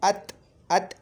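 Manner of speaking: अत said firmly behind the teeth; letter demo. Shown as a demonstration. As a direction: tap out अत. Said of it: "देखो ये दांत के पीछे से निकल रहा है".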